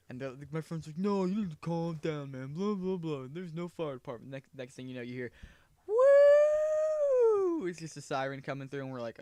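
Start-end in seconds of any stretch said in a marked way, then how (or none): 1.0-4.3 lightly voiced friend, a bit higher; brushing off the worry in a mock friend voice; dismissive friend. Friend brushing off the warning, lightly voiced and dismissive. 5.9-7.7 high loud siren whoop; siren imitation. Siren coming down the street, loud high whoop.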